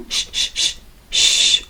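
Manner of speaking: long hushed sh, no voice; sh sound. Shown as a demonstration. Teacher showing students the German sh sound. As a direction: hiss "sh" softly through the teeth. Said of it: "nos va a dar este sonido de sh".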